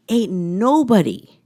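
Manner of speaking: slow, drawn-out sassy ain't nobody; sassy mode. Acted. Vlogger slipping into a sassy voice, drawn-out. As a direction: lean into the sass, slowly. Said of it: "I don't know why I'm talking like this".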